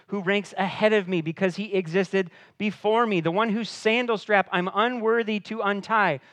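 a prophet gently scolding his puzzled followers; John the Baptist voiced. John telling his disciples why they shouldn't be surprised, a lightly voiced rising protest.

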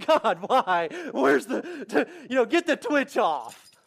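hammed-up comic wail; mock crying. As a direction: pretend to blubber a complaint to God. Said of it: "see me crying on the sideline going".

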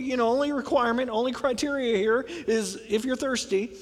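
ordinary delivery

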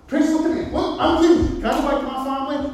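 high, elated burst to the priest; breathless with joy, speeding up at the end; excited healed leper. Acted. Acted healed leper pleading to priest.

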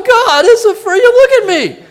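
high, loud, laughing cry to God; hammed-up laughing exclamation; acted laughing. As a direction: imitate hysterical laughing while praying. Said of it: "I'm just laughing".

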